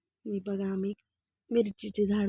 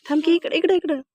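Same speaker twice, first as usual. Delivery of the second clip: urgent call; 7 semitones higher, 7 dB louder than usual. Real, not acted. Child shaking the camera.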